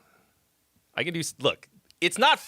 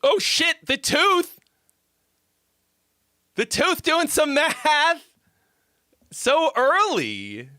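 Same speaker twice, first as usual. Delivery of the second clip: much higher and louder, thrilled greeting; excited shout; 13 semitones higher, 5 dB louder, slower than usual. Real, not acted. Viewer arrives, streamer shouts in excited surprise. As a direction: streamer hyped to see the Tooth show up early.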